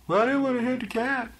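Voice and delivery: high mocking voice of the driver; mocking whiny excuse. Mocking the woman driver's excuse, high and whiny. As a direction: mimic her excuse in a high prissy voice.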